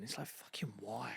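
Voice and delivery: whispered, exasperated; soft whispered why; whispered aside. Real, not acted. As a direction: breathe it out under your breath.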